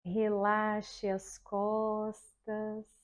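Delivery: slow, soft, soothing relaxation voice; guided meditation. Shown as a demonstration. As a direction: lull the class into calm, very slowly. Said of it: "E aí você vai começar assim, ó".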